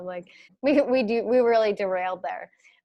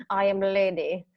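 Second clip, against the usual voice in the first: put-on lightly Russian-accented proclamation; 2 semitones lower, 3 dB quieter than usual. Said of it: "that sounded Russian".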